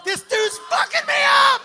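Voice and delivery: high, loud panicked yell; panicked shout. Retold or imitated. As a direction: shout it out in disbelief. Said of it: "I'm like".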